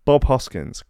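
normal talking voice

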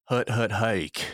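slow, drawn-out snap count; quarterback calling cadence. Pretend. Football segment break, snap call.

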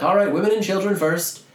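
high, loud officious call; mock crew call. Crewman calls out the lifeboat order. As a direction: bark it like a ship's officer.

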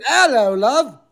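high-pitched put-on British call; mock-screamed British accent. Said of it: "and I'm screaming".

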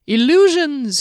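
a showman unveiling a secret; grand little conjurer; halfling Skimnoddle. A narrator's reading. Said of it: "he said".

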